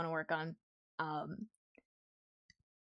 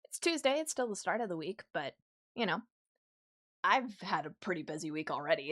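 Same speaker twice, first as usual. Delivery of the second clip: usual speaking voice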